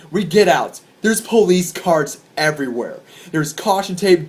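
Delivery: plain reading voice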